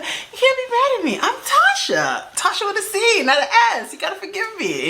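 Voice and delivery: very high, sing-song pleading; hammed-up cute begging; playful wheedling. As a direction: wheedle for forgiveness in a little high voice. Retells wheedling the driver for forgiveness.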